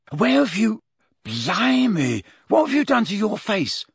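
put-on high-pitched, startled boy's outburst; shocked disbelief. Ron goggles at Harry's face, shocked. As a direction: blurt it out, voice leaping up in alarm.

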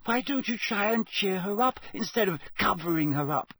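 high, urgent schoolgirl voice; a clever girl pleading for the elf; indignant Hermione. Acted. Hermione scolds the elves.

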